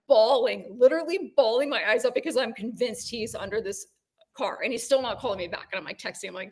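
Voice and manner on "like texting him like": ordinary delivery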